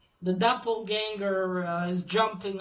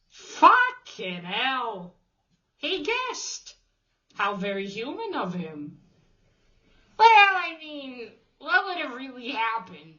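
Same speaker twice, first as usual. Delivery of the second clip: exasperated disbelief; 4 semitones higher, 4 dB louder than usual. Real, not acted. Reacting to the stranger's guess, exasperated. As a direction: throw up your hands at the plot.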